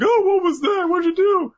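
very high, loud yelp; hammed-up high-pitched bewilderment; mock startled alarm. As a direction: squawk the questions in pretend shock. Zapped character reacts in alarm.

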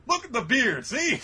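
high, loud hammed-up insistence; acted insistent denial. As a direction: play a badly disguised man pleading his case. Repeats Luffy's disguise plea.

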